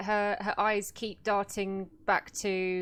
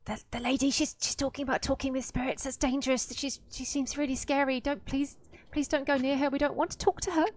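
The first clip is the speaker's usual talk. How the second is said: higher-pitched, anxious spirit voice; pleading spirit voices; 6 semitones higher than usual. Acted. Spirits warning a player about the scary lady, pleading in a higher voice. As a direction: plead as a worried little chorus of spirits. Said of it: "your spirits are whispering at you".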